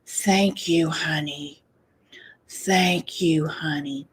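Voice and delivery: low, hushed mom voice, fading to a whisper; soft whisper. Retold or imitated. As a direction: breathe it like a grateful mother in the background. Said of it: "you hear the mom in the back".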